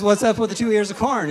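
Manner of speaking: loud, high baffled question. Shown as a demonstration. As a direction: call it out puzzled and loud. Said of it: "it's good".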